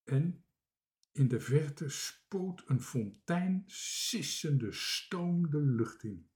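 usual speaking voice

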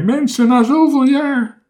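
high, loud, overcome wail; sobbing. A narrator's reading. Castaway seeing people after so many years, sobbing. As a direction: cry it out with emotion. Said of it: "snikte hij".

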